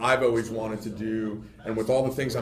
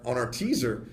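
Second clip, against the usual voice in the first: usual speaking voice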